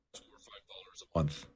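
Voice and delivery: very soft whisper; whispered aside. Real, not acted. Host slipping in the monthly price, whispered. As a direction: drop to a barely audible whisper. Hushed aside after the rant.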